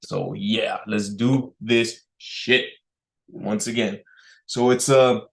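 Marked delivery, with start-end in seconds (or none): none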